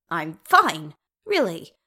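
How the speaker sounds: insist, with the voice cracking. A narrator's reading. Bilbo insists to the royals he is fine, voice cracking. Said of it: "when his voice cracked".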